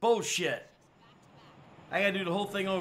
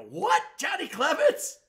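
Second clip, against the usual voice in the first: higher, loud excited outburst; 11 semitones higher, 3 dB louder than usual. Retold or imitated. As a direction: recall the thrill of seeing him. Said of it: "I'm like".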